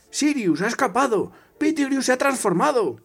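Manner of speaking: high-pitched alarm call; Harry, crying out. Acted. Harry alerts Sirius that Pettigrew has transformed, crying out. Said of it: "gritó Harry".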